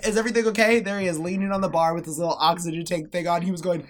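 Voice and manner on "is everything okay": higher, louder alarmed question; worried call. Retold or imitated. Granddaughter rushing downstairs, alarmed call. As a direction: call out in a panic. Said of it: "and I said".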